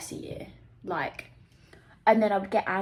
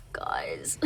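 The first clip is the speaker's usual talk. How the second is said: breathy, hushed "guys", low; whispered plea; 5 dB quieter than usual. Real, not acted. To her viewers, shaken after the play, whispered. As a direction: whisper it, overwhelmed. Overwhelmed after seeing Prima Facie.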